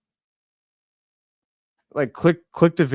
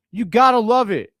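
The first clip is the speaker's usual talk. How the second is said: a loud, higher cheer riding the high of the block; excited cheer; 6 semitones higher, 6 dB louder than usual. Real, not acted. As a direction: shout the praise out. Fan cheering right after the block.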